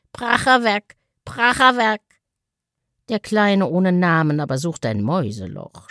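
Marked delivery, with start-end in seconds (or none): 0.1-2.0 higher, odd duck voice, falling cry; a limping duck grumbling as it waddles off; duck's exclamation. A narrator's reading. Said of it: "mit dem Ausruf". (quakender Entenausruf, fallend)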